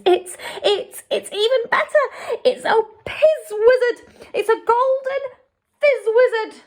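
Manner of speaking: high, loud, laughing with delight; bubbling over with joy at the catch; thrilled. A narrator's reading. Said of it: "with a thrill in his voice".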